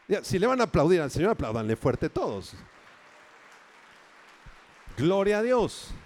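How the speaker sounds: loud, fast call for the crowd to clap for the Lord; rousing call to applaud. Real, not acted. Pastor urging the congregation to applaud, loud and fast. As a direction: fire up the congregation, loud and quick.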